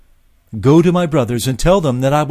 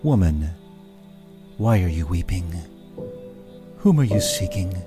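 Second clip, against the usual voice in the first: lightly lowered, gentle and firm; strong gentle voice; 4 semitones lower than usual. A narrator's reading. Jesus asking the weeping woman, gently and firmly. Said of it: "with a strong and gentle voice".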